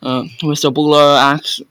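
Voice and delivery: plain reading voice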